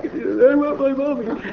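put-on high, slow whimpering toddler voice; mock tearful child pleading for mommy; crying child. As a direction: whine it like a crying little kid wanting mom. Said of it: "and they're crying".

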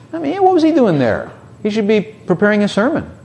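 high-pitched scandalized critic; mock indignation. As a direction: imitate a disapproving busybody. Mocking critics of Jesus at party.

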